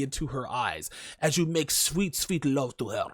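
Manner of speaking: mock the romantic lover; mock-romantic croon; mock tender. Mocking romantic sex.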